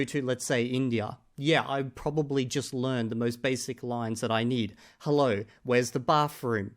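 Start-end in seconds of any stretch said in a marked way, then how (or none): none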